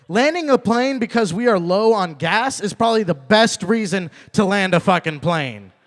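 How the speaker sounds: normal talking voice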